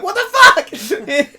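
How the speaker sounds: high, slow laughing what; laughing disbelief. Retold or imitated. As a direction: drag it out through laughter. Laughing at bad answer.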